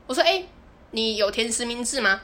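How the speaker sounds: ordinary talk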